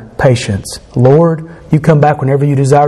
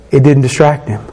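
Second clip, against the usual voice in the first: usual speaking voice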